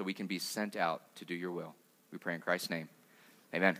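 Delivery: hushed pastor's prayer fading toward amen. Acted. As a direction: pray it quietly, bowing into each plea. Pastor leads opening prayer.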